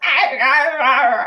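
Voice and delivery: loud mock groans of pain; mock labor groans. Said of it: "I was like".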